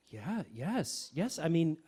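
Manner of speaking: ordinary delivery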